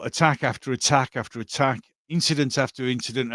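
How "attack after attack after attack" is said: ordinary delivery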